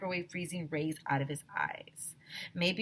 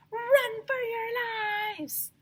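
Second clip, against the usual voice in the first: high, very slow crying shout; hammed-up panic; panicked scream; much slower than usual. A narrator's reading. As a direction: wail it in terror. Panic in story.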